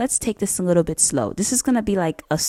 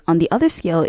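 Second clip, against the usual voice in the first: normal talking voice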